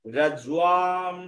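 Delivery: রাজ্যুয়াম with a long, held final syllable; pronunciation model. Shown as a demonstration. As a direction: draw out the ending of রাজ্যুয়াম to show it. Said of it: "জর পরে অনুস্বার থাকলে".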